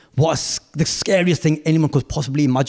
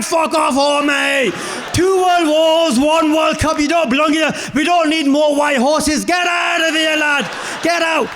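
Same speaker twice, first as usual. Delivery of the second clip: high-pitched, hammed-up shouting; strained comic yelling, drawn out; mock rant; 12 semitones higher, slower than usual. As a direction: order them out in pretend outrage. Rehearsed rant ordering them out.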